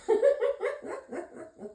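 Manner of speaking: a high burst of laughter; a high laugh after the rant. Real, not acted.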